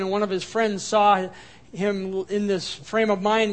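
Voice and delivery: ordinary talk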